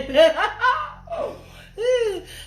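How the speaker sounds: high, loud burst of laughter; real laugh at a viewer. Real, not acted. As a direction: crack up at the message.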